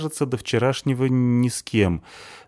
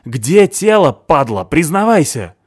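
loud, slow furious yell; 7 semitones higher, 9 dB louder, slower than usual. A narrator's reading. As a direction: bellow it in his face. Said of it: "заорал я на него".

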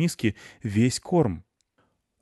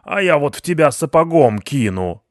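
loud, higher-pitched angry threat; shouted threat; 7 semitones higher, 10 dB louder than usual. A narrator's reading. Husband threatening the cat with a boot, shouting. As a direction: yell a threat at the intruder. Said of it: "закричал вышедший на шум муж".

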